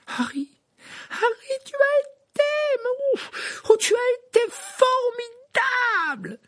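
very high, slow tearful shriek; piercing cry. A narrator's reading. Hermione shrieking her relief at Harry, piercing. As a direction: shriek with relief near tears. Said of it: "d'une voix perçante".

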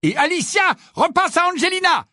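high-pitched excited match commentary; cried out. A narrator's reading. Lee commentating the match to the stadium, crying out. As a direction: call the pass over the crowd. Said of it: "s'écria Lee".

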